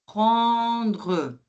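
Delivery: normal talking voice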